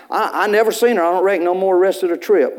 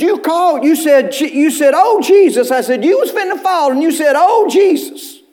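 hoog en luid met lachjes, half lachend nagedaan; laughing retelling; 9 semitones higher, 4 dB louder than usual. Retold or imitated. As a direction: vertel het lachend, hoog en opgewonden na.

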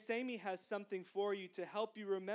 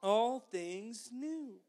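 slow, emphatic repeat, word by word; slow recitation; 4 dB louder, much slower than usual. Shown as a demonstration. Teacher has children say it one more time, slow recitation. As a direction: lead the group slowly through each word. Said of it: "Say it one more time".

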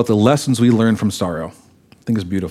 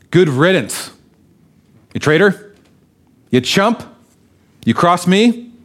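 higher, sneering dismissal of a traitor son; mock scorn; 5 semitones higher than usual. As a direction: spit it out like a father glad he's gone. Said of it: "Doesn't say".

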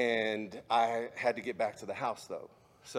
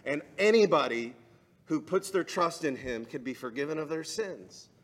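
louder, higher, driving the gospel home; full-force preaching; 4 semitones higher, 7 dB louder than usual. Acted. Preacher proclaiming the cross to the congregation, loud and fervent. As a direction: preach it out to the whole room.

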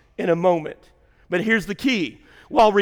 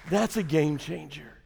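softer, lower repeat of game changer; quiet echo; 4 semitones lower, 7 dB quieter, slower than usual. Shown as a demonstration. Pastor echoing his point to the congregation, quietly. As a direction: let game changer fall away to a hush. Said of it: "repeated line, softer echo".

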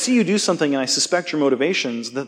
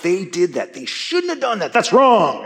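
rising higher and louder, "That's wrong" snapped; indignant; 5 semitones higher, 3 dB louder than usual. Retold or imitated. Preacher mimicking a self-righteous believer, mounting indignation. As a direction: huff it out like someone stewing over another's sin. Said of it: "because I'm mad about it".

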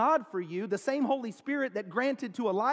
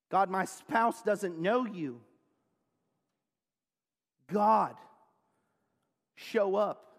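pleading prayer; slower than usual. Acted. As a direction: plead with God for each person. Leads example intercession prayer.

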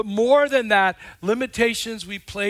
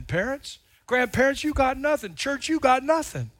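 ordinary delivery

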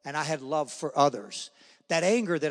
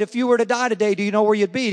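louder, higher, pressing question; retold insistent repeat; 4 semitones higher, 8 dB louder, faster than usual. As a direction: belt it out to someone who didn't get it. Repeats question after John's "What?.

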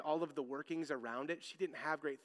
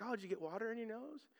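gentle dad question; 6 semitones higher, 7 dB quieter, faster than usual. Retold or imitated. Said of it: "And I'm like".

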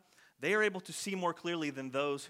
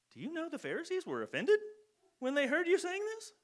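much higher, rising, worried question; anxious disbelief; 11 semitones higher than usual. Retold or imitated. Disciples telling Jesus he offended them, alarmed rise. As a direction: ask it wide-eyed and alarmed.

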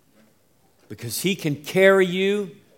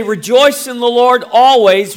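louder and higher, pressing on always; emphatic mock quote; 8 semitones higher, 10 dB louder than usual. Shown as a demonstration. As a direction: belt out the wrong version. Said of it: "No, it says".

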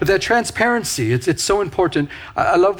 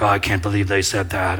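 lower, indignant muttering; mock the petty grudge under your breath; a lightly voiced offended churchgoer; upset grumbling; 8 semitones lower than usual. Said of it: "and you're getting all upset".

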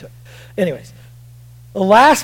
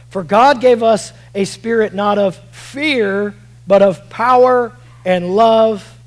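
slow, drawn-out scripture reading with swelling key words; emphatic scripture reading; 3 semitones higher, 7 dB louder, slower than usual. Real, not acted. Preacher reading a verse on power and love, slow emphatic reading. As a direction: weigh each phrase of the verse slowly.

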